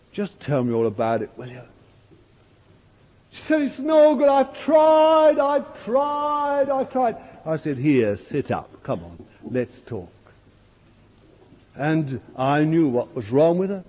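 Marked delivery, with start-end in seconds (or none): 0.1-1.6 soft coaxing. Retold or imitated. Pastor gently coaxing distressed woman. 3.6-7.2 sobbing plea. Retold or imitated. Preacher voicing a weeping woman before the Lord, high anguished sobbing. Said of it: "sobbing her heart out". 7.8-10.1 soft soothing. Retold or imitated. Pastor soothing the weeping woman.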